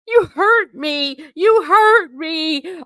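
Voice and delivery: high, loud, slow fake wail of hurt; mock crocodile tears. Mocking the narcissist faking hurt, a hammed-up high wail. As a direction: ham up the wounded victim, slow and shrill. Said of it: "Crocodile tears is one of their favorite things".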